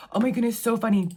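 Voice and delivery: usual speaking voice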